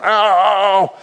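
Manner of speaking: high, sharp retold "Ow!"; a tribesman's yelp after a dog bite, imitated; mock yelp of pain. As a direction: imitate the bitten man's yelp, half in fun. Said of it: "the guy's screaming".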